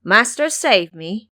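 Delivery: loud, slow plea called out; calling out from afar. Acted. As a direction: stretch it out as a distant cry for help. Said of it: "hear someone call out".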